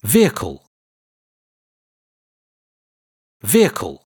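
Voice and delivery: "Vehicle" as two syllables, VEER-kul; drill model. Shown as a demonstration. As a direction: repeat model "Vehicle" with pauses. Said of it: "Repeat after me".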